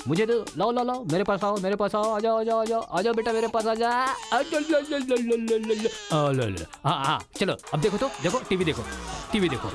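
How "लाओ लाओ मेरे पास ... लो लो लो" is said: higher, sing-song coaxing; coaxing baby talk. Acted. Father coaxing his fussing baby into his arms. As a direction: lure the baby over playfully.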